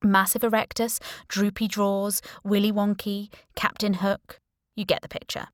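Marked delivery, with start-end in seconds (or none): none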